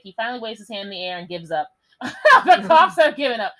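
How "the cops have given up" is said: laughing through the words. Real, not acted. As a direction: read it cracking up. Laughing while reading.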